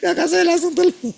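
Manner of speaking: high, loud mock woman's voice on the phone; mimicking sister-in-law on the phone. Preacher imitates sister-in-law on speakerphone, high and loud. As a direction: squeal it like a loud caller on speaker.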